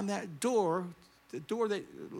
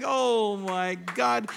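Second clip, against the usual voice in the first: dismayed inner thought; 8 dB louder, much slower than usual. Retold or imitated. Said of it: "I think".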